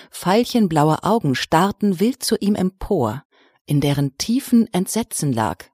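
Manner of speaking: plain reading voice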